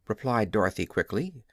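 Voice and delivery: ordinary delivery, nothing marked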